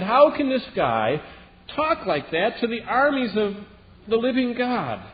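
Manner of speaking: David protests loudly over the giant's taunts; indignant young David. Acted. Preacher voicing David, indignant and rising high.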